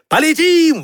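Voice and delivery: high, eager storybook cry; high-pitched rallying call; exclaiming. A narrator's reading. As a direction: exclaim it high, ready to take off. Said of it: "воскликнул Мишка".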